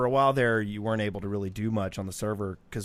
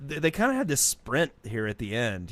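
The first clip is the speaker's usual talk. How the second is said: ordinary delivery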